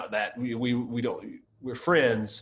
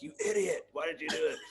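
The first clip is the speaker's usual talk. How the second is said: self-scolding yell; 5 semitones higher, 4 dB quieter than usual. Retold or imitated. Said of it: "I'll start yelling at myself".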